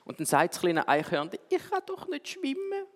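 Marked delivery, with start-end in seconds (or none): 1.5-2.9 little squirrel. Acted. Little squirrel tells mother its fear.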